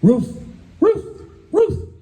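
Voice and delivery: high-pitched barking on a word; talking dog. Acted. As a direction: woof it out like a comic dog. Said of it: "And the dog says".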